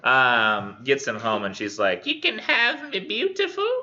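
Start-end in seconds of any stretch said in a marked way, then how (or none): 2.1-3.7 very high Cockney hag voice; hag's accent. Acted. Hag offers her new husband a choice, high Cockney hag voice. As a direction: croon it high as a Cockney crone. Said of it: "the accent goes away".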